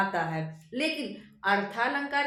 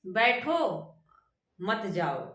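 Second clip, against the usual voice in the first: बैठो set apart, a clear break, then a lower मत जाओ; pause after बैठो; 2 semitones lower, slower than usual. Shown as a demonstration. Teacher shows how tone changes meaning, pausing after बैठो. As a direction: stop after बैठो, keep मत जाओ together. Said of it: "कहने के अनुसार उसका अर्थ बदल जाए".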